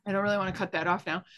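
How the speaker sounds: ordinary talk, nothing marked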